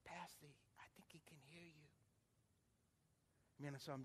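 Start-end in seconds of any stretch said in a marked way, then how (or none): 0.0-1.9 imitated near-whisper, very soft; hushed daughter. Daughter tells pastor he can hear, hushed. Daughter murmurs at father's bedside.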